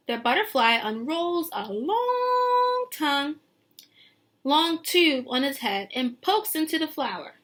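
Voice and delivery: lilting picture-book read; reading to children. Acted. Teacher reading the book text to her class, lilting. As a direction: paint the scene for the kids.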